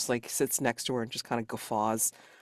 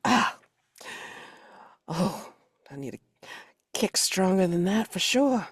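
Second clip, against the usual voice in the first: tough woman voice; 7 semitones higher, 4 dB louder than usual. Acted. Character wants stronger whiskey.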